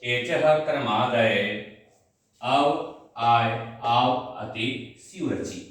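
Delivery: measured classroom recitation of the sutra; slow sutra recitation. Shown as a demonstration. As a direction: recite the rule slowly, syllable by syllable. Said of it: "ये सूत्र कहते हैं".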